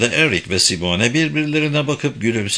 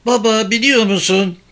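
put-on higher-pitched boy calling his dad; excited son; 11 semitones higher, 4 dB louder than usual. A narrator's reading. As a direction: burst in with news, voice pitched up. Boy bursts in calling his father. (babasına seslenen heyecanlı oğlan sesi)

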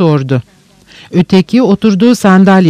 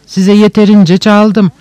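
usual speaking voice

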